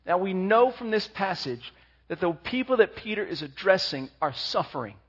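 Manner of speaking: normal talking voice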